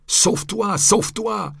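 high, loud repeated cry; crowd shout. A narrator's reading. Onlookers at the market shout at the friar to flee, high and loud. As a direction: yell at him to run. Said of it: "ils lui crièrent".

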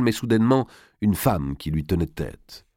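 usual speaking voice

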